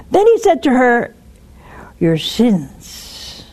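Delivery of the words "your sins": soft, low words of forgiveness; quiet, tender absolution; tender reassurance. Acted. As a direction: gently assure her she is forgiven. Jesus reassures the woman.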